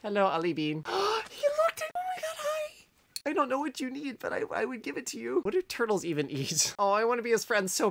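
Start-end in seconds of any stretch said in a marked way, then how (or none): 0.9-5.4 tiny high, soft squeal; overwhelmed adoration. Real, not acted. Player adores the turtle looking back, overwhelmed squeal. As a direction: squeak softly, overcome by cuteness.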